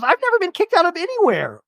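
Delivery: high, hammed-up indignant Jen; mock shock. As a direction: squeak it out in disbelief.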